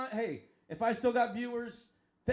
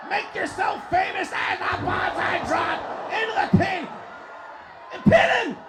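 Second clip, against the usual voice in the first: loud, high, excited ringside call; live play-by-play; 9 semitones higher, 9 dB louder than usual. Acted. Commentator calling a video game tag match to viewers, shouting. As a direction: call the match at full throat.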